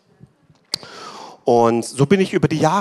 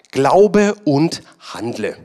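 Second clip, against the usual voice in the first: very slow, every word weighted; a preacher's drawn-out battle cry; slow emphatic slogan; 4 dB louder, much slower than usual. Acted. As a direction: hammer out "Glaube und handle" word by word.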